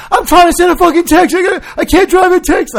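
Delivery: high, loud mad rant; retelling the rant at the cop; frantic yell. Retold or imitated. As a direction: yell it all mad at him. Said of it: "it was like".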